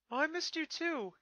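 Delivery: put-on high, slowish boy voice; young dragon, touched. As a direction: return the feeling simply. Spike answers hug.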